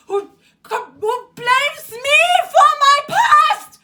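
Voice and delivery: real high-pitched angry shout; outraged outburst. Real, not acted. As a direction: burst out yelling at being blamed. Outburst about father's blaming email.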